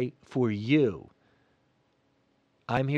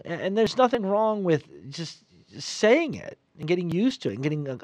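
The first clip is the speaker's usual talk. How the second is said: ordinary delivery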